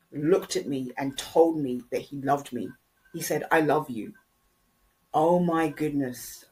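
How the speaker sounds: normal talking voice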